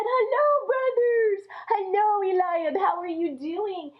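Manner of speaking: put-on a boy happy to see his brothers; little brother calling out a cheery hello; bright eager greeting.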